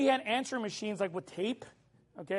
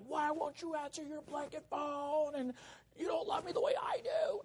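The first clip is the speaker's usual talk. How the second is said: mock cussing girlfriend; 6 semitones higher, 8 dB quieter than usual. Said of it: "she's, like, cussing on there".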